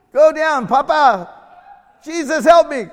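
loud, high kiddie shouting; high-pitched playful holler; imitated excited children. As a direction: yell it like a child at play. Voices children playing horsey with dad.

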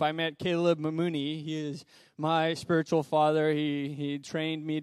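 ordinary talk

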